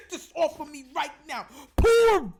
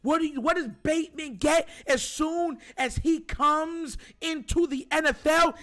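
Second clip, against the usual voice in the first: ordinary talk, nothing marked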